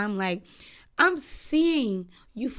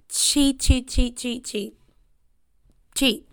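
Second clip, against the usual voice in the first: emphatic repetition; 2 semitones higher, 6 dB louder, slower than usual. Real, not acted. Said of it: "repeated emphatic chant".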